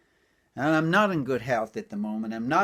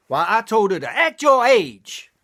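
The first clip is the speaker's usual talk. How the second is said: loud punchline setup; 7 semitones higher, 6 dB louder than usual. Acted. Punchline build.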